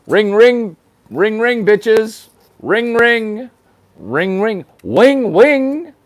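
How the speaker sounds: high, loud sing-song ringing; phone ring bit. Acted. Mock ringing, high loud sing-song.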